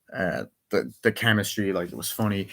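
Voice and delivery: usual speaking voice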